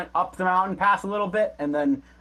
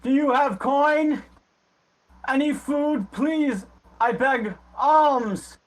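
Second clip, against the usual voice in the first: high, drawn-out beggar's call; beggar shouting out; 10 semitones higher, 4 dB louder, slower than usual. Acted. Beggar begs passers-by for coin and food, shouting out. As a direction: call out pleadingly for alms. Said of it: "he starts shouting out".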